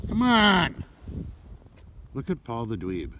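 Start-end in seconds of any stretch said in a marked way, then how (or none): 0.1-0.8 calling out. Real, not acted. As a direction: call the dog, higher. Calling dog across ice.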